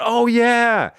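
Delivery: a hint of an eager radio pro; radio guy. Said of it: "He said".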